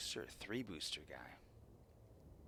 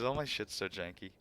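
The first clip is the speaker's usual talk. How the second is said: normal talking voice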